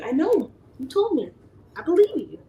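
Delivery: high, sing-song giving in; playful concession. Retold or imitated. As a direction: concede with teasing sweetness.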